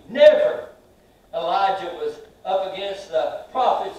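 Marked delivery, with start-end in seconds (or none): 0.1-0.7 loud, much higher cries of never; preaching at full force. Acted. Preacher to the congregation, crying out never at full force. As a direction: shout never back at every trouble.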